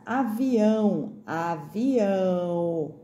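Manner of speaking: "avião" stretched slowly on the nasal ão; drawn-out ão. Shown as a demonstration. Teacher sounding out spelling for pupils, "avião" very slow with a long nasal ending. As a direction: sound out "avião", lingering long on the last syllable. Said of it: "lembrando do ão".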